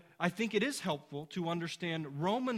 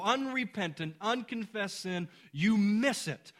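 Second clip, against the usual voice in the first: loud, slow, higher forceful preaching; preaching at full force; 4 semitones higher, 6 dB louder, slower than usual. Acted. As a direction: hammer the point home from the pulpit. Sermon climax, full force.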